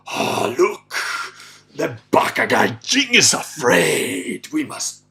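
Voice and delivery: slow, loud, drawn-out villain voice; menacing Japanese captain. Retold or imitated. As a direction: imitate a captain sizing up scared prey. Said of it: "I can almost feel him thinking".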